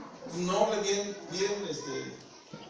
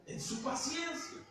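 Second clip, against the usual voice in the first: hushed and slow, higher, marvelling; soft slow reverence; 7 semitones higher, 6 dB quieter, slower than usual. Shown as a demonstration. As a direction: let the phrase sink in gently, much softer. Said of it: "léalo de la manera que Dios nos quiere hablar".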